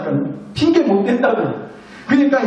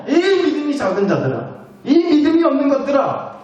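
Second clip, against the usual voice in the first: mock rebuke; 7 semitones higher than usual. Jesus rebuking the disciples for little faith, mild scolding. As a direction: scold them in a raised pitch. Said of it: "믿음 없음을 뭐라 하신".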